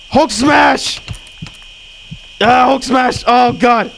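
playful Hulk cry with each hammer blow; Hulk battle cry. Pretend.

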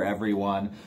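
ordinary delivery

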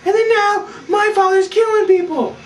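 very high mock-alarmed squeal; panicked girl impression. Girl wakes him in panic.

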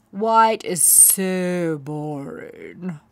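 slow, drawn-out scorn; bored sneer. A narrator's reading. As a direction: dismiss her like she's dull. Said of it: "said Plumpkin".

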